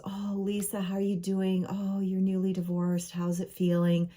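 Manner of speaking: cloying, gloomy concern, put on; Debbie Downer. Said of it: "I call it the Debbie Downers".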